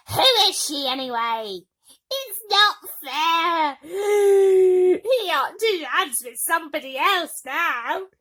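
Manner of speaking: jealous sulking stepsister. Acted.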